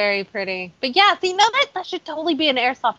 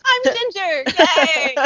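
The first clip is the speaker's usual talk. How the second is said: high, cheering self-introduction; excited high-pitched name and yay; perky sign-off; 5 semitones higher, 3 dB louder, much slower than usual. Pretend. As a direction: pipe up with a high, playful cheer.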